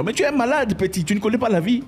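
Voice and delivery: high-pitched incredulous scolding; squeaky mock outrage. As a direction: exclaim it high, in mock disbelief at someone naive. Mocking outburst at a gullible person.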